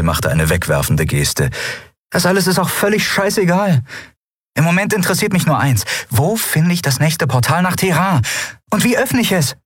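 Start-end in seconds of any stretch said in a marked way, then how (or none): 2.1-9.6 high, insistent, hurried figure voice; impatient heroine. A narrator's reading. Endriel demands the portal's location from Yunnan, high and impatient. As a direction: push urgently for the one answer that matters.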